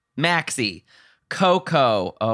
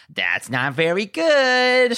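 slow, higher mock judge; Michelle; 6 semitones higher, much slower than usual. Co-host quotes a rehearsal judge, slow mock critique. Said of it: "Michelle's like".

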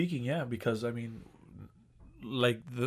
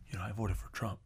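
very soft, low imitation of a secret admission; hushed confession; 5 semitones lower, 13 dB quieter than usual. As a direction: confess it under your breath, as if ashamed. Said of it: "exactly that tone".

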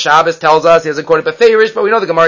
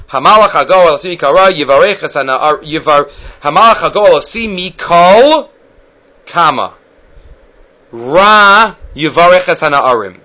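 Kol, then a long pause, then Ra yivarech; misplaced comma pause. Shown as a demonstration. Rabbi demonstrating the wife's wrong comma, with a pause after Kol. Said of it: "put the comma in Hamalach Hagoel as follows".

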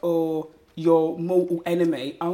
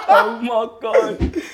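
louder, slow, laughing through it; laughing disbelief; 10 dB louder, much slower than usual. Real, not acted. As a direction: crack up, can't believe it. Cracking up at his answer.

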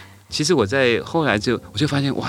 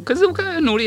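put-on much higher, louder wailing child's protest; crying child; 4 dB louder, much faster than usual. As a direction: wail it like a hurt little girl. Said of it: "她就大哭".

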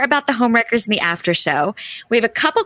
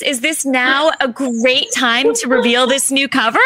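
ordinary delivery

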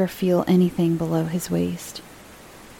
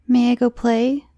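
put-on child's voice; 6 semitones higher, 3 dB louder than usual. Child asks.

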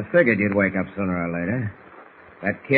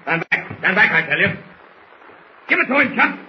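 panicked shout; 9 semitones higher than usual. Acted. Bull panicking, calling to henchman.

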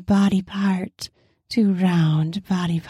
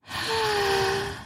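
pleasured sigh; 11 semitones higher, 7 dB quieter than usual. Acted.

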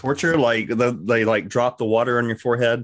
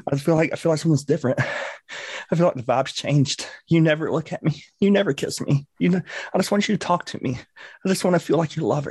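higher whiny pleading lover voice; pouty passive aggressive lover; 6 semitones higher than usual. Pretend. Ghost sulking at the man like a neglected lover. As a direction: sulk and beg for attention. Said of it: "real passive aggressive look".

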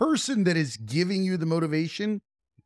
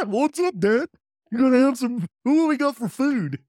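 high, louder eager dog voice; cartoon dog; 6 semitones higher, 4 dB louder than usual. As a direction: play the hungry pup talking to dad. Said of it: "Everybody responds for their dog".

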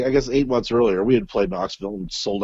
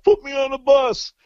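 demanding diva. Retold or imitated. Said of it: "on the bus by himself".

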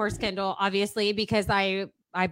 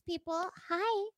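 phone sex voice; 2 semitones higher than usual. Pretend. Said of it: "phone sex".

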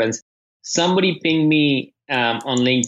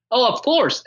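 put-on high, bright kid voice; lightly voiced eager little boy; eager young son; 10 semitones higher than usual. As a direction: play the excited eight-year-old. Son eager to read review.

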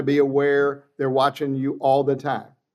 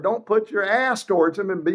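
ordinary delivery

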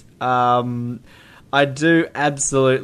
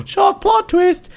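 mock shock; 4 dB louder, slower than usual. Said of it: "I'm like".

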